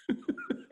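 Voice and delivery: amused laugh. Real, not acted. Laughing at the punchline.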